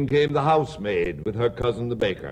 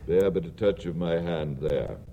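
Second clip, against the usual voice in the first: a hushed, low spirit voice; low, quiet ghost; 7 semitones lower, 4 dB quieter than usual. Acted. Ghost of Christmas past telling Scrooge what he will see, in a low hush. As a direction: speak low and soft as a spectre.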